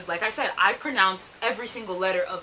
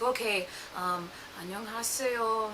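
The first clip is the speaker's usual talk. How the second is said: tentative learner's attempt; 2 semitones lower, 10 dB quieter, faster than usual. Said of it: "every time I try to say something".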